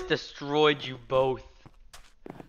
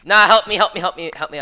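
panicked plea; 5 semitones higher, 10 dB louder than usual. Real, not acted. Gamer fleeing attackers.

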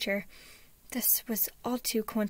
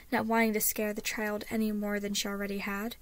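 usual speaking voice

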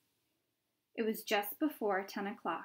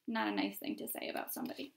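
whispered aside; 6 dB quieter, much faster than usual. Real, not acted. Reader's quick aside.

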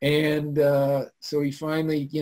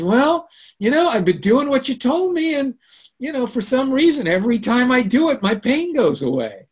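excited friend; 11 semitones higher, 4 dB louder than usual. Retold or imitated. Friend's excited report retold.

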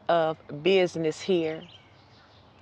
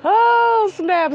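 sudden squeal, much higher and louder; surprised oh snap; 13 semitones higher, 8 dB louder than usual. Real, not acted. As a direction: yelp in delighted surprise. Bird gets rejected, she reacts.

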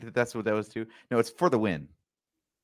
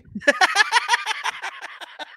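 high giggle. Real, not acted. Laughing at own parody.

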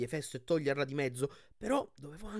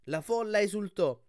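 plain reading voice

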